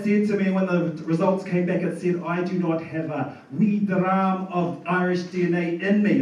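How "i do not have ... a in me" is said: a light Scottish lilt on "wee dram"; mock Scottish. Comic reads his ancestry result aloud in a mock Scottish accent. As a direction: read the DNA result like a Scot. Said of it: "that sounds like Scottish".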